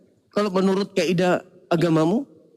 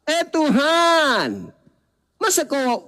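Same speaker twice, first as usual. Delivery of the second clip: high, long-drawn call to God; stretched, protesting cry; mock shouting ghost nurse; 5 semitones higher, much slower than usual. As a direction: wail out a hammed-up complaint. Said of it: "Teriak lagi suster ngesot".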